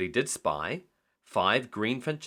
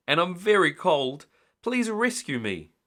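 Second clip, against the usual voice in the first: pleading; 7 semitones higher, 4 dB louder than usual. A narrator's reading. Pleading for rescue.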